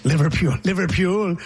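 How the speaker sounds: mimicked Scouse accent on the city name; playful copy of a Liverpool accent. Shown as a demonstration. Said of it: "Who are you, [name]?".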